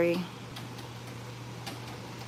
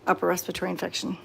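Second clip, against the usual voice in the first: ordinary talk, nothing marked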